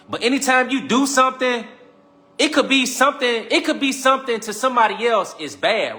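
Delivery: preaching at full lift; fired-up preaching. Real, not acted. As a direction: raise the voice to make the point. Preaching emphatic point.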